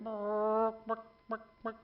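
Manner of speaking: clucking bark-bark sounds; chicken noise. Retold or imitated. Waiter imitating a chicken to describe the soup, clucking. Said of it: "make a noise like a chicken".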